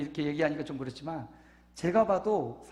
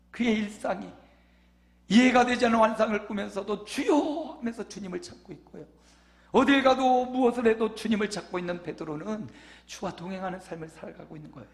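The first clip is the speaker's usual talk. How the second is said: louder, higher ringing sermon voice; full-force preaching; 6 semitones higher, 6 dB louder than usual. Acted. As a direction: proclaim the promise to the whole church. (힘차게 외치는 설교)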